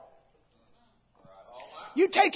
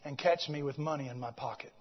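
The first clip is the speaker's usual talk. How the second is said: much lower and softer; hushed confession; 11 semitones lower, 9 dB quieter than usual. Retold or imitated. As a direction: confide the fear quietly. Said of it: "He said".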